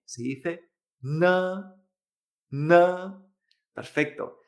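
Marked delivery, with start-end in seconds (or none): 1.0-1.8 "na" with an n as in Spanish, vowel held; Chinese N sound. Shown as a demonstration. Teacher demonstrates N E, "na" with a Spanish-style n. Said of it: "exactamente igual que la N". 2.5-3.2 "na" with an n as in Spanish, vowel held; Chinese N sound. Shown as a demonstration. Teacher demonstrates N E, "na" with a Spanish-style n. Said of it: "exactamente igual que la N".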